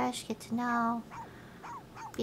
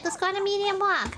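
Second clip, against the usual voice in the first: raised excited puppy voice; high eager dog voice; 7 semitones higher, faster than usual. Acted. Streamer voicing the dog wanting a walk, high eager. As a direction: sound like an eager pup.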